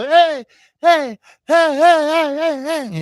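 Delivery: high bright calling hey hey; cheerleader calls. Retold or imitated. Cheerleaders walking down the street, high calling chant. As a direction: chant like a pom-pom girl.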